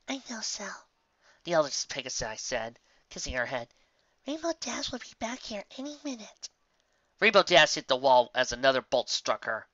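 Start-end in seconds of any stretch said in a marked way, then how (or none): 0.1-0.7 soft reassuring voice. A narrator's reading. Fluttershy reassuring. 4.3-6.5 soft reassuring voice. A narrator's reading. Fluttershy reassuring.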